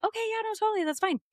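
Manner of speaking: very high-pitched, over-casual agreement; high, placating retold reply; forced agreement. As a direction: squeak out that it's all okay. Playing along, unsettled, with his cover.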